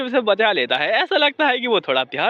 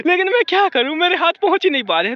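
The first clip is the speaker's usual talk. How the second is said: flustered; 7 semitones higher, faster than usual. A narrator's reading. Embarrassed girl explaining.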